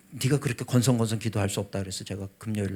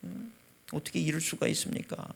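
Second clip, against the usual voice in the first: retold breathy, strained plea to God; tearful pleading prayer; 6 semitones higher than usual. Father begging God outside the operating room, tearful. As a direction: re-enact a desperate prayer, voice thinning at the end. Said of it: "눈물을 펑펑 흘리면서 기도를 하는".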